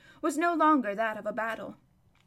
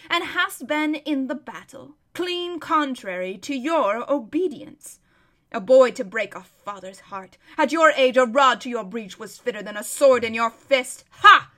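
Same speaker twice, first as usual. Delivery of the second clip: gruff scolding, building louder; 7 dB louder than usual. A narrator's reading. King scolding his son after the battle, gruffly. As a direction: scold gruffly, ending on a loud huff. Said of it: "in a very gruff la-, voice".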